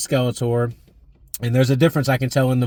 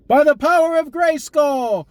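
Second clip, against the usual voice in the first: He-Man battle cry; slower than usual. Acted. Ending catchphrase call.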